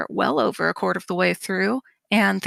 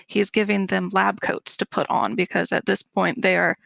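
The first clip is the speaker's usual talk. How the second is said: normal talking voice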